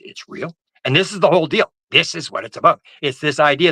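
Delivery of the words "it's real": breathless whispered disbelief; stunned whisper. Retold or imitated. Hearing the secret is real, stunned whisper. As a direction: barely voice it, stunned.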